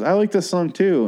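high, chirpy imitation of his girlfriend; mock girlfriend voice. Retelling the bar fight, imitates his girlfriend's high mock chirp. Girlfriend retold, she goes.

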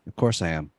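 low, soft, cool flirtation; smooth flirty reply. Retold or imitated. Retelling the reply to gym crush, low and flirty.

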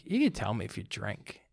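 low, soft imitation of a dad; lightly voiced dad, hushed and low; confidential dad. Dad inviting his son to own up, confidential. Voicing dad's confidential talk.

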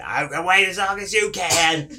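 high, loud stubborn challenge; angry mock yell. Driver yelling at hidden kids, imitated angry challenge. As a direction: dare the hiders to outlast you. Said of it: "he's just yelling".

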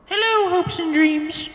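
very high, slow sing-song squeak; cutesy greeting. Pretend. Greeting hopes and dreams in the bowl, high drawn-out coo. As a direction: coo it in a tiny falsetto.

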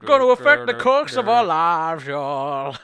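loud, slow, high put-on drawl; hammy dramatic voice. Podcaster riffs on the episode title, loud drawn-out mock drama. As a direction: belt it out slowly like a hammed-up proclamation.

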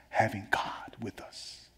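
slow, low near whisper; hushed awe. Acted. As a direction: drop to a soft, drawn-out hush. Hushed climax of the point.

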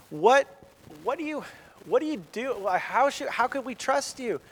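mock-baffled king questioning an upstart; incredulous king. Saul doubting David, acted.

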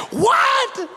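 high-pitched incredulous "What?"; retold shock, voice leaping up; stunned disbelief. Young believer reacting to the chart's beasts, stunned.

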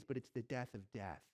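ordinary delivery

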